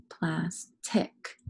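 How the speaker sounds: "plastic" split into plas, tic; syllable by syllable. Shown as a demonstration. Teacher picking out the second syllable, "plastic" in two parts. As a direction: slowly separate the two halves of "plastic". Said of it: "Second syllable".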